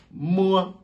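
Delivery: "Mo" called high and loud; quiz answer letter. Shown as a demonstration. Teacher revealing the quiz answer, high. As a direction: announce "Mo" as the answer. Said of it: "nous avons".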